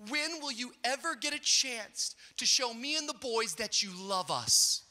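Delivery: retold wifely frustration; frustrated wife. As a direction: plead in mock exasperation, drawing out the last words. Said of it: "she was so frustrated".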